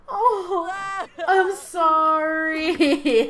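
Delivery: very slow, wailing mock apology; drawn-out mock apology. Vlogger apologising to the grieving Sim, slow mock wail. Apologising while laughing at mourning.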